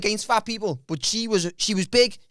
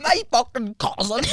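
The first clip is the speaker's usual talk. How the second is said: put-on slow, drawn-out Irish accent; comic Irish impression of her; mock Irish accent. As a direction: put on an Irish accent, savouring each word. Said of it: "She's not even Irish".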